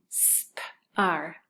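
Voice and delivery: "sp-ar" as separate s, p, ar; tapping out sounds. Shown as a demonstration. Teacher inviting say it with me, sound by sound. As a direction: sound out "sp-ar" slowly for others to join. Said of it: "say it with me".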